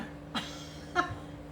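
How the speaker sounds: a quick airy giggle up high; a little whispered giggle; high breathy giggle. Real, not acted. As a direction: giggle to yourself, light and high. Giggle before singing.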